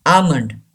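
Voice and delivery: almond as "AH-mund"; almond, drawn "ah", then "mund"; silent L, long A. Shown as a demonstration. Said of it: "A gives the long sound".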